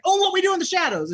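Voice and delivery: squealed show title, half in fun; imitating an excited yell. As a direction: mimic the presenter blurting the title out. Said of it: "she yelled it really, like, fast".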